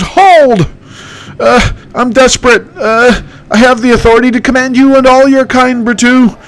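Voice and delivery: stammering, squeaky heir; Candace voice. Acted. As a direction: hero bluffing his authority, high. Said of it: "that's my impression".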